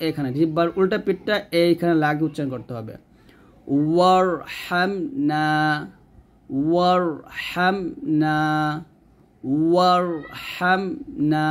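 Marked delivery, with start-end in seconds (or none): 3.9-11.5 ওয়ারহামনা drawn out slowly with rounded lips and a thick R; heavy R in recitation. Shown as a demonstration. Teacher to students, repeating ওয়ারহামনা to show a heavy R. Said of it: "এরকম".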